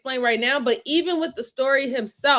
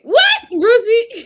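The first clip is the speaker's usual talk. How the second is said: high, loud, drawn-out disbelief; slow, high incredulous yell; playful outrage; 9 semitones higher, 8 dB louder, much slower than usual. Real, not acted. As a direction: call Brucey out loudly, stretching it. Mock outrage at Brucey, then laughing.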